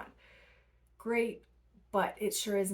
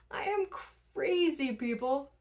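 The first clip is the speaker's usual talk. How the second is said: excited; 7 semitones higher, 4 dB louder, slower than usual. Real, not acted. Laughing confession about barefoot jogging.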